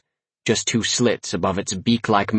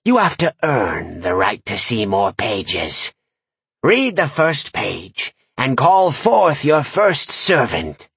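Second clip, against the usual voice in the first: slow commanding witch; slower than usual. Acted.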